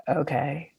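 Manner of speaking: soft, small okay; retold tentative reply. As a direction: answer quietly, holding back. Hesitant reply to an unexpected question.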